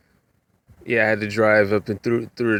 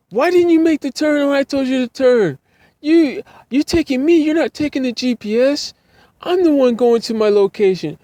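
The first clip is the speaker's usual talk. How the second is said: much higher, louder mock yelling; yelling old lady; 4 dB louder than usual. Angry passenger yelling at the driver, high mock yell. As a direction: scold him at the top of a high voice. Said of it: "she's yelling at me".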